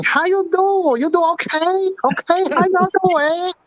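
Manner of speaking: high mock Chinese accent; Mister Wang voice. [name] playing Mister Wang to the hosts, high mock Chinese accent. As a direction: raise the pitch into a broad Chinese accent. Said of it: "I can talk to you like Mister Wang".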